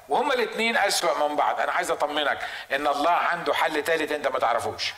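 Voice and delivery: ordinary talk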